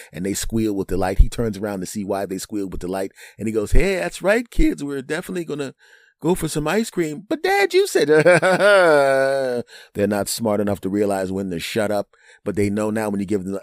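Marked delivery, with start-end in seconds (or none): 3.7-7.2 high, loud, hammed-up dad voice; over-jolly dad. Dad promising kids ice cream, loud put-on cheer. 7.3-9.9 high, loud mock child voice; protesting kid. Kid protesting to dad over his promise, high mock wail.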